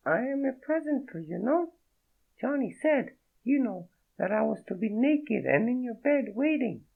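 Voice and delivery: a startled French woman, voiced high; French accent. Naked woman startled by Fredo, in a high French accent. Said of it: "The accent was real".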